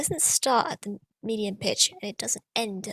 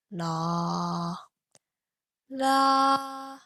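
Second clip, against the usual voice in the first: third tone demo; much slower than usual. Shown as a demonstration. Teacher demonstrating the dipping third tone. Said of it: "falling part first".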